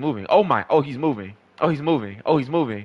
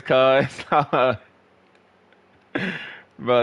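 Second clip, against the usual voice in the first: usual speaking voice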